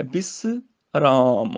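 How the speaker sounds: ਬਿਸਰਾਮ slow and low, the a on ਰ, bis-raam; ਬਿਸਰਾਮ ਹੌਲੀ ਉਚਾਰਨ; careful pronunciation. Shown as a demonstration. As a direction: stretch ਬਿਸਰਾਮ to show sraa. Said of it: "ਰਾਰੇ ਨੂੰ ਲਾਇਆ".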